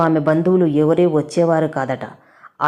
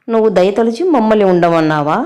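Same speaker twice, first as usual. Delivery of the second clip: a touch of indignant anger, pitched up; angry question; 5 semitones higher, 4 dB louder than usual. A narrator's reading. Mother-in-law challenging her daughter-in-law, mildly angry. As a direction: throw the question back at her, offended. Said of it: "కోపంగా అడిగింది శాంతమ్మ".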